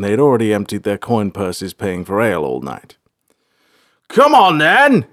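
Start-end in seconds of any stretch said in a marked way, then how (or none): 4.1-5.1 high, loud wail; wailed battle cry. A narrator's reading. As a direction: wail out a challenge to a fight. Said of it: "he wailed".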